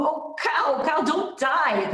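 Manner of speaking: high, drawn-out plea; distressed pleading. A narrator's reading. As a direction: plead with a dying animal. Girl pleads with dying cow.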